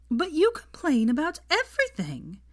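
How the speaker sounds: high, helpless protest; objecting helplessly. A narrator's reading. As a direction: object in rising disbelief. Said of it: "she objected helplessly".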